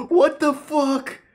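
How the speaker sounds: very high, slow shocked cry; shocked shriek. Real, not acted. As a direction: gasp it out in disbelief. Seeing explicit message.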